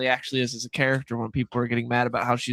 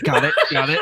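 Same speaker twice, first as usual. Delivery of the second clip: mocking rehearsal; 2 semitones higher, 4 dB louder than usual. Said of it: "Pre-read it in his mind".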